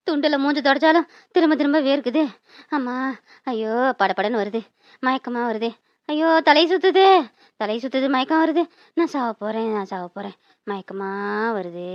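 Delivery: low, soft and weakening to almost nothing; fading away. Acted. Aunt believes she is dying, faint fading voice. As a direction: sink into a faint as you speak.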